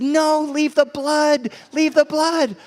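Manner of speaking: put-on high, insistent little-kid plea; protesting child. Son refusing to let parents clean his chin, protesting.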